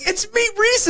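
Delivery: put-on very high, breathless girlish voice; voicing Sarah. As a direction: squeak it like the startled young woman. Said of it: "and she's like".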